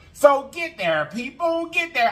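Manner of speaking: high, rousing cheer; excited urging. Real, not acted. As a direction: fire up the viewers. Cheering exhortation.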